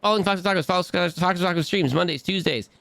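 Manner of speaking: hyped shoutout. Acted. Streamer giving chat a hyped shoutout. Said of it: "Don't do the shouty outies".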